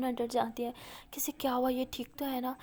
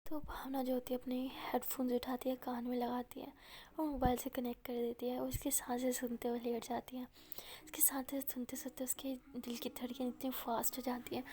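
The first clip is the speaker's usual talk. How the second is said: soft unvoiced storytelling whisper; whispered narration of a girl falling for a voice; 7 dB quieter than usual. A narrator's reading. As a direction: draw the listener in with a hush.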